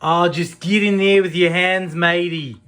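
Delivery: loud, hammed-up Aussie accent; a terrible stab at an Australian accent; bad Australian accent. Wife calls out to Jim in a terrible Australian accent. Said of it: "That was terrible".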